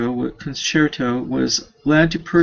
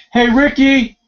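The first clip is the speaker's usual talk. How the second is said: high, loud call across the house; 11 semitones higher, 6 dB louder than usual. Real, not acted. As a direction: yell for Ricky in another room. Reader calls to Ricky mid-reading.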